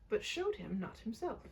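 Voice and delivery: ordinary delivery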